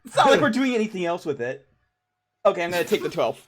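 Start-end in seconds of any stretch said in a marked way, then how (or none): 0.0-1.7 loud, higher, talking through laughter; laughing protest. Real, not acted. Player defends spending a lucky on the roll, laughing.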